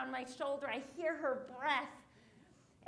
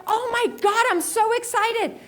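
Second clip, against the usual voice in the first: excited; 8 semitones higher, 15 dB louder than usual. Retold or imitated. Reaching front, sees her idol.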